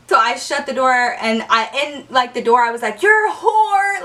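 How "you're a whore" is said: high, slow sing-out jeer; teasing taunt. Retold or imitated. Girl to her friend through the door, teasing taunt. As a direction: stretch it out to make fun. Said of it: "I was making fun of her".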